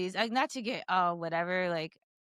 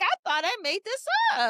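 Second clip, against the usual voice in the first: high-pitched, louder amazed disbelief; incredulous; 12 semitones higher, 4 dB louder than usual. Retold or imitated. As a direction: retell your astonishment, pitched right up. Author shocked at real-life character.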